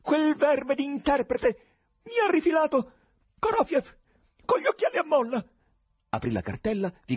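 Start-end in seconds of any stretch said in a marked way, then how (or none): 0.0-5.5 high, loud, disjointed cries; frantic shouting. A narrator's reading. President searches his folder to prove innocence, frantic shouting. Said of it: "gridando frasi sconnesse".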